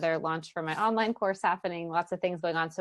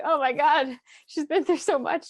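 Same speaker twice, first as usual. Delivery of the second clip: high, loud, breathless "oh my God"; overwhelmed gasp; 9 semitones higher, 7 dB louder than usual. Retold or imitated. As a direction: gush it out, suddenly far higher and louder. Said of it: "And I'm here like".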